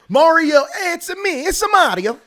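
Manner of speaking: high, loud Italian plumber voice; big cartoon Mario bit. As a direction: shout it like Mario himself. Mario voice joke.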